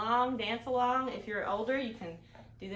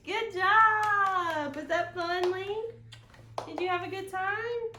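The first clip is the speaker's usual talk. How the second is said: excited praise; 9 semitones higher, 6 dB louder, slower than usual. Real, not acted. Praising her baby son.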